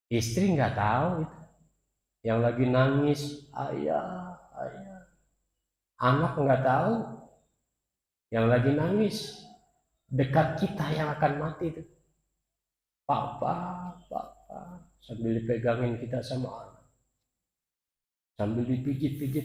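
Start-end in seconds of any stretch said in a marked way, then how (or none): 3.5-5.0 soft tearful calling of ayah; a child weeping by the deathbed; mock crying child. Crying child calls father. 13.0-14.9 soft tearful calling of papa; mock weeping child. Crying child calls father.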